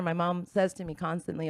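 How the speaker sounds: usual speaking voice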